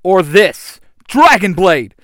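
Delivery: loud, high weapon call; battle-cry yell. A narrator's reading. Red Ranger calling up his sword, defiant loud yell. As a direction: shout it as a defiant answer. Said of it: "the Red Ranger yells".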